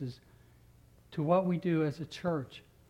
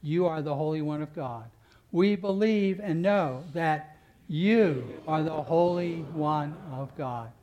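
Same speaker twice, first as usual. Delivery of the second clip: "you" pressed, slow and louder through the confession; stress on you; 4 dB louder than usual. Acted. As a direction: lean on every "you", slowly. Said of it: "Now we're gonna emphasize".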